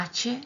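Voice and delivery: "H" said as acca, with a held double c; Italian letter name. Shown as a demonstration. Teacher showing the Italian H, clear letter-name demo. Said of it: "en italiano se pronuncia".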